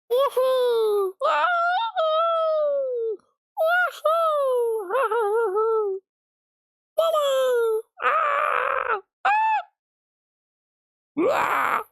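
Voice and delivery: very high loud woohoos and yells; wild whoops. Real, not acted. Streamer celebrating loud enough to worry headphone users, wild whooping. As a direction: whoop and shriek with glee. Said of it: "Was I too loud".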